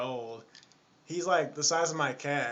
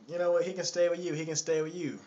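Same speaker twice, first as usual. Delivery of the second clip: higher, louder mock fed-up woman; 5 semitones higher, 5 dB louder, faster than usual. Lady leaves the baby with the man, mock fed-up. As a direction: imitate a woman dumping the job on him.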